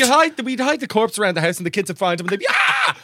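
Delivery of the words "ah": very high, loud startled scream; kids' scream. Pretend. Kids finding the corpse hidden in the house, screaming. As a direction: shriek like a child who's found a body.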